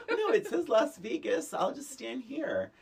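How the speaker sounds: high, bright mock voice of the hitchhiker. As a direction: play her as a clueless hitchhiker, well up in pitch. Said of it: "she's like".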